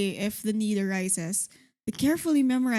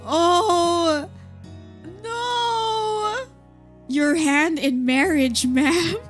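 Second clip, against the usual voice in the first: loud, high mock proposal; dramatic; 7 semitones higher, 5 dB louder, much slower than usual. Real, not acted. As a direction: propose with over-the-top gusto.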